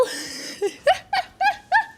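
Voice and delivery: a very high giggle; high breathy giggle. Real, not acted. Laughs after the joke.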